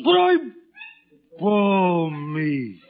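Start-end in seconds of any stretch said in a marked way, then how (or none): 0.0-0.4 sudden high loud protest; outburst. Acted. Father protesting to his wife, sudden loud outburst. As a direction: burst out before being cut off.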